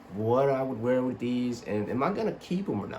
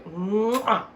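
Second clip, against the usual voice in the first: chef's kiss; 9 semitones higher, 4 dB louder than usual. Real, not acted. Chef's kiss over shoes.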